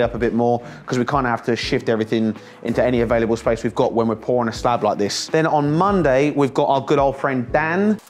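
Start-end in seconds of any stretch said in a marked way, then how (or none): none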